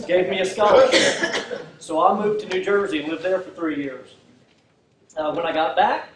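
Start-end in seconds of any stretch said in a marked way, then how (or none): 0.1-1.6 slower, louder, chuckling at his own joke; laughing through it. Real, not acted. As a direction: let a laugh ride under the punchline. Joke about New Jersey scholarship.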